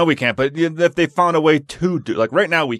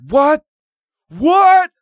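much higher, louder astonished what; amazed disbelief; 7 dB louder than usual. Retold or imitated. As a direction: blurt it out, mind blown. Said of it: "He's like".

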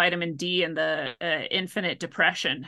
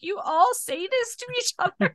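much higher, incredulous question; retold disbelief; 13 semitones higher than usual. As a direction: ask it in wide-eyed disbelief. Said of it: "I'm like".

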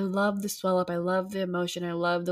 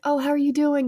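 nice guy greeting; 8 semitones higher, 4 dB louder than usual. Retold or imitated. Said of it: "a guy trying to be nice, being like".

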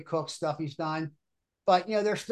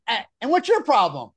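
loud, challenging demand; squaring up to the coach; confrontational mom; 5 semitones higher, 8 dB louder than usual. Retold or imitated. Mom confronts the coach with a loud challenge. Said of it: "the lady says to me".

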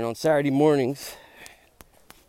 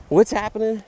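loud, higher, startled question; 8 semitones higher, 7 dB louder than usual. Retold or imitated. Vlogger retells hearing a roar mid-practice, startled. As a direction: jolt out of the headphones in alarm. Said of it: "I'm like".

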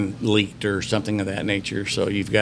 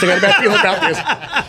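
much higher, louder, laughing through the worry; high nervous mock panic; 10 semitones higher, 5 dB louder than usual. Friend whispering fear ringside, repeated in high mock panic. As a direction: squeal it like a spooked friend. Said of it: "David grabs me and goes".

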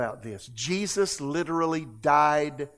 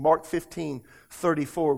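ordinary talk

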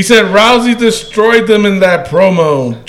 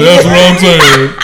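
put-on voice; 4 dB louder than usual. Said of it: "That voice".

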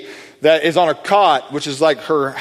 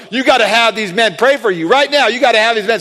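eager urging; 5 semitones higher than usual. Retold or imitated. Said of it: "She's like".

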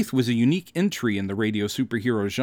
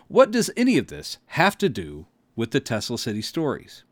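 ordinary talk, nothing marked

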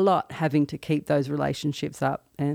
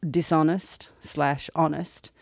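normal talking voice

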